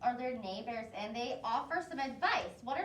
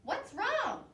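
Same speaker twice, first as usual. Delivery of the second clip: concerned brother; 8 semitones higher than usual. Acted. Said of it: "he comes in and says".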